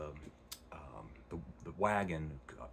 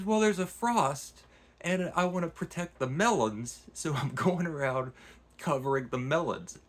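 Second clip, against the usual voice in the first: comic gardener; 8 semitones higher, 6 dB louder than usual. Retold or imitated. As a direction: explain patiently as the naive gardener, much higher. Gardener explains about the frost.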